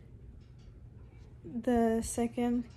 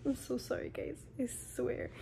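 whisper; 7 dB quieter than usual. Real, not acted. Laughing whispered apology.

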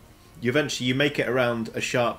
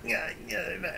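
buzzing bee noise; 10 semitones higher, 7 dB quieter than usual. Acted. Bees buzzing against the smoke.

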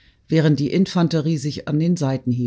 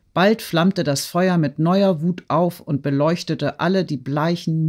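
usual speaking voice

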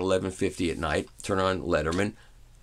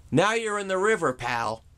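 higher, wisecracking host jab; mock Letterman; 10 semitones higher than usual. Letterman mocks the test CD narrator after smashing it, with a wisecracking jab. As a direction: toss off the punchline, pitched up.

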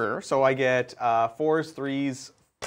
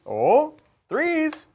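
playful callout; 3 dB louder than usual. Real, not acted. Playful call of the dice target.

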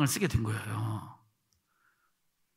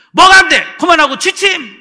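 high, loud scolding commands; angry ordering; 9 semitones higher, 13 dB louder than usual. Retold or imitated. Evangelist telling a praying boy to sleep, angry ordering.

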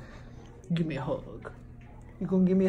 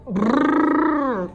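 loud shivery brrr; shivering brrr; 3 semitones higher, 5 dB louder than usual. Shown as a demonstration. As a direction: lips trilling from the cold. Prompt "Cold" acts out cold.

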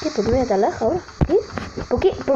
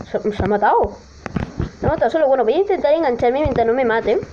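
ordinary talk, nothing marked

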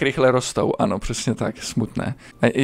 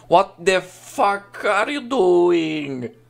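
high, very drawn-out disbelief; mock outrage; 7 semitones higher, much slower than usual. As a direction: stretch every word in theatrical indignation. Exaggerated outrage at game. (přehrané, protáhlé rozhořčení)

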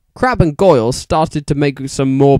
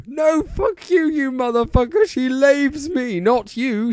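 shouted; 12 semitones higher than usual. A narrator's reading. Vampire shouting at Draco in a squeaky voice. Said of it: "shouted Vampire".

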